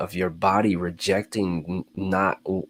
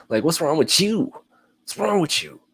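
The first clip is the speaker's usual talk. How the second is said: mock outrage, much higher; mock indignant body; 10 semitones higher, 4 dB louder than usual. The body berating its owner, mock outrage high. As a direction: squeal at yourself in mock disbelief. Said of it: "Your body's pissed".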